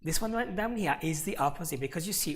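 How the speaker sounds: ordinary talk, nothing marked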